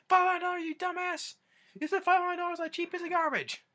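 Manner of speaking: high, loud mock rage at the price; imitated angry yell. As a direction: yell it like an outraged grandpa. Grandpa raging over the price.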